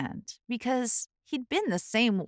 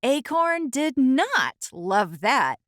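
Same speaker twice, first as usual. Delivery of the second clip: usual speaking voice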